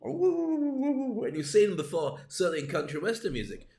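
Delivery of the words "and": high and slow, stretched like a harmonica note; drawn-out high voice. Pretend. Host to audience, stretched high and slow. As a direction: pull the words out long and high.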